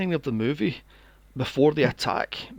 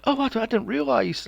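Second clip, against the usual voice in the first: high, ditzy mock girl voice; airhead girl; 9 semitones higher, 3 dB louder than usual. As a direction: play her clueless and sugary. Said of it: "And she's like".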